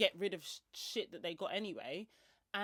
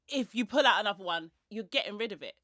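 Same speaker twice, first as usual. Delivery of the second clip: louder, stern ultimatum; firm warning; 9 dB louder than usual. Retold or imitated. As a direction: lay down the law firmly. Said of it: "I'm like".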